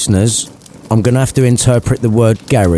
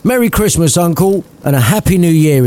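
cheery call; 8 semitones higher than usual. Acted. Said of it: "he yelled".

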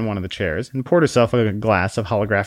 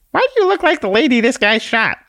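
very high, tiny squeaky voice; a tiny exasperated sprite scolding; squeaky little Conscience; 5 dB louder than usual. Acted. Conscience snaps at the man in a very high squeak.

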